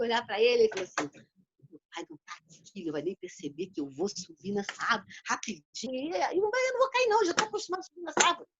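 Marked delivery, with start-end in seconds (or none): 1.7-6.2 put-on hushed, secretive child muttering to himself; sneaky hushed plotting. Boy alone in the yard, scheming in a hush. 6.2-8.5 put-on high, fast childish bravado; a kid talking himself into it; cocky little boy. Boy in the yard convincing himself, high and eager.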